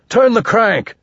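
loud, high roar. A narrator's reading. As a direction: roar the order out. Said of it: "Sweet roared".

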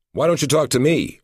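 high-pitched, insistent challenge; protective demand. A narrator's reading. Husband shielding his wife from two agents, protectively demanding. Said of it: "Nicholas demanded, protecting his wife".

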